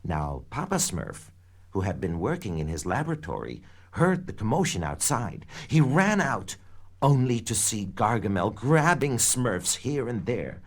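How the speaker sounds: usual speaking voice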